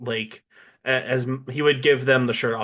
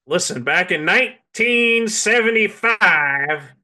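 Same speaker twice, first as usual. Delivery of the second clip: put-on slow, higher old baseball historian; old-timey voice; 6 semitones higher, slower than usual. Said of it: "Only baseball historian".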